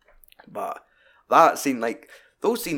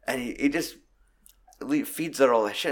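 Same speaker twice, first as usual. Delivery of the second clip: usual speaking voice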